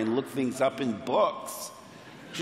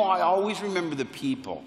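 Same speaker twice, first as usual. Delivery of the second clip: ordinary delivery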